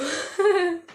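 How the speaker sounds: high, bubbling laugh; amused laugh. Real, not acted. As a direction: laugh at your own joke. Laughing at her own joke. (अपने मज़ाक पर हंसी)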